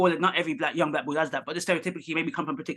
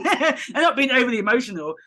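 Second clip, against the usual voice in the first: laughing, higher and louder; 8 semitones higher, 6 dB louder than usual. Real, not acted. Explaining to the host, laughing through it. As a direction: laugh the words out as you insist. Laughing while making her point.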